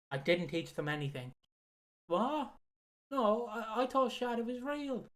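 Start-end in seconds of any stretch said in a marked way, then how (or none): 2.1-5.0 mock surprised Tony Khan. Voicing Tony Khan in bit.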